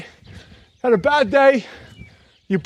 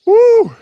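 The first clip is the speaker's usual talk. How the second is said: high loud joyful woo; excited whoop; 12 semitones higher, 8 dB louder than usual. Real, not acted. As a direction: whoop to fire up the class. Instructor whoops mid-workout.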